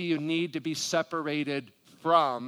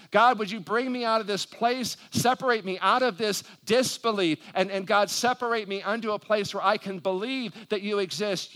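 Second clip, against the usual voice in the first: higher, forceful pleading prayer; praying aloud; 5 semitones higher, 4 dB louder than usual. Pretend. Preacher models a prayer for the congregation, pleading fervently. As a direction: press each plea to God, voice lifted and insistent. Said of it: "that you just need to say".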